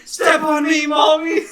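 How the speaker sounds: high, loud imitation of a begging fan; mock scream. Imitating people in the game chat, mock scream. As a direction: scream it like an overexcited chat user. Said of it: "be screaming".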